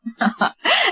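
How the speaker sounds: a short amused laugh; light laugh. Acted. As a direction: laugh at his grumbling. Wife laughs at husband.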